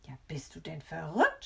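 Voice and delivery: indignant question with a rising end; shouting back. A narrator's reading. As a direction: scold back in disbelief. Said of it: "schreit die Oma dagegen".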